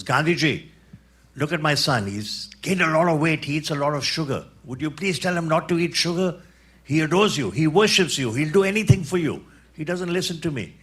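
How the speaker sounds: pleading mother. Retold or imitated. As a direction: beg the great man to set your son straight.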